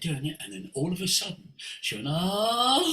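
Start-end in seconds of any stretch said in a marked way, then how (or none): 2.1-2.9 "ah" rising and breaking into sobs; imitated crying. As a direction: let a high vowel collapse into acted sobbing. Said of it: "crying from her soul for five minutes".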